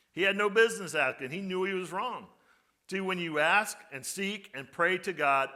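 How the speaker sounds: usual speaking voice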